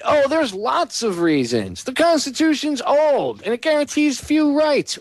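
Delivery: high, slow, sneering mock voice; mocking the article. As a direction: parody the writer in a prissy high voice. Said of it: "That's a bland statement".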